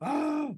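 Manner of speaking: high acted cry; frightened yelp. Snake learns it is the favorite food, high yelp.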